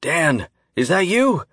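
calling from far away, high and slow; loud but distant. A narrator's reading. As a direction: shout down a faint phone line. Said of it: "his voice loud but distant".

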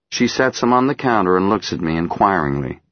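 plain reading voice

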